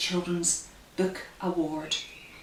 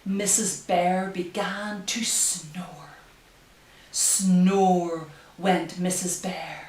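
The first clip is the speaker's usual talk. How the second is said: slow, hushed storybook reading; reading to children; 5 dB louder, slower than usual. Acted. Reads picture book aloud.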